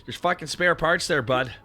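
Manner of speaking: high, loud Canadian threat; strong Canadian accent. Acted. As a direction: taunt him in a thick Canadian accent. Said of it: "very strong Canadian".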